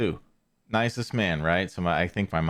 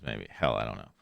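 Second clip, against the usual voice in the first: ordinary talk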